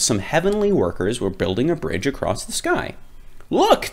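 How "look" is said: high excited call; shouting worker. Acted. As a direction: call out in surprise. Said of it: "they shouted".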